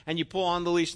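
normal talking voice

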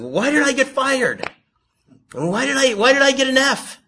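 high, loud hammed-up complaint; mock whining. People asking God why, loud mock whining. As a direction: wail it like a put-on victim.

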